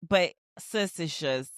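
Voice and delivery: usual speaking voice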